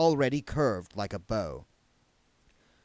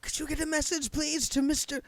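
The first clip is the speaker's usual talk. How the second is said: higher, urgent spirit voices pleading; clamoring ghosts. Acted. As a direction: crowd of dead begging for messages.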